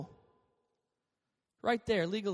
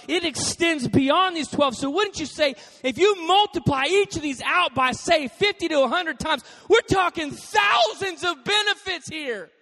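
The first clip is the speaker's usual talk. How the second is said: preaching at full force; 7 semitones higher, 7 dB louder than usual. Real, not acted. Pastor to congregation, preaching in a loud rising shout. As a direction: build it up to a big shout.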